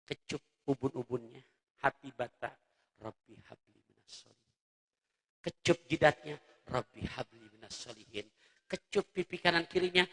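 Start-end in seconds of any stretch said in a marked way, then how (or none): 3.0-4.6 put-on whispered prayer over a sleeping child; soft prayer. Shown as a demonstration. 6.6-8.2 put-on whispered prayer over a sleeping child; soft prayer. Shown as a demonstration.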